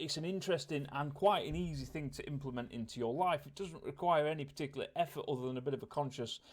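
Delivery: normal talking voice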